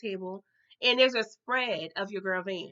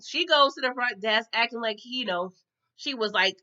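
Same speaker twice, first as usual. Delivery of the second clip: normal talking voice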